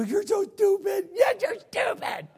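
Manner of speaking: very high-pitched, drawn-out cooing at a pet; gushing dog-praise voice. Pretend. Preacher talking to a dumb dog, gushing sweetly. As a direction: squeal an insult as if it were loving praise. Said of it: "your tone and body language also speak".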